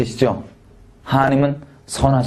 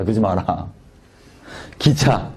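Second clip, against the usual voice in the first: ordinary delivery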